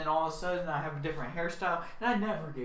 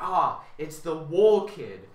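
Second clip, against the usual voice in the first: loud, drawn-out mock exclamation; mocking crowd; 8 dB louder, slower than usual. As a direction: imitate people jeering, slow and loud. Others mockingly name the wool kid.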